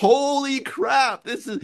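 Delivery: high, drawn-out gasp of disbelief; amazed. Retold or imitated. Man retelling his shock at hearing a Boston accent, amazed. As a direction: stretch it out high and loud, awestruck. Stunned reaction to woman's accent.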